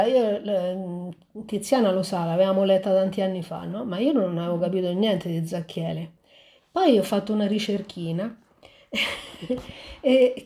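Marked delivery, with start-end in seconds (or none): none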